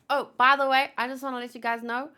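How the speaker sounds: plain reading voice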